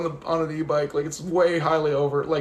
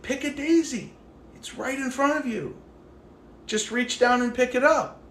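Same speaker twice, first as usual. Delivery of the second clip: pitched-up, eager coaxing; coaxing a stranger into the daisies, voice raised up; higher coaxing urge; 9 semitones higher, 3 dB louder than usual. Retold or imitated. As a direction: lean in and urge her on with a raised voice. Urging stranger to pick.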